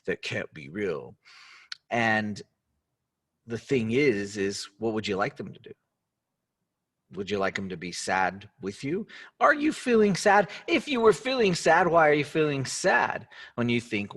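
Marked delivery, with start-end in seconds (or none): 0.0-1.2 low, scoffing mock voice of a doubter; mocking skeptic. Imitating people who doubt a happy friend, low mocking voice. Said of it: "when people tell others". 9.4-13.3 high, loud probing questions; pressing question. Real, not acted. Coach challenging the audience about their sadness, high and pressing.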